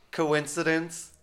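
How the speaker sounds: mock-innocent; sarcastic. Real, not acted. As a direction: let the doubt hang, arched. Sarcastic aside on timing.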